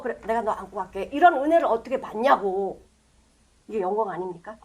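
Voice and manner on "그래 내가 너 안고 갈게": tender Jesus voice. Acted. Jesus comforts the blind man.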